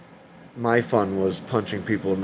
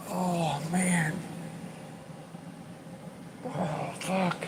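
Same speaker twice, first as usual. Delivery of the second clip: softer, higher, choked-up sighs; real overwhelmed; 7 semitones higher, 9 dB quieter, much slower than usual. Real, not acted. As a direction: let the emotion catch your breath. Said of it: "I'm having a moment".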